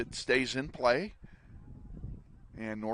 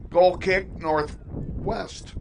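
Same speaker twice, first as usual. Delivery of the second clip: louder, higher formal announcement; stadium announcer; 4 semitones higher, 5 dB louder, much slower than usual. Real, not acted. Formal announcement after the call.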